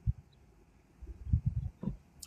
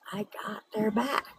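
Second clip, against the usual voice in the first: a hushed, confiding whisper; soft whispered aside to the viewers; 4 semitones higher, 11 dB quieter than usual. Real, not acted. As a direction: whisper it like a secret.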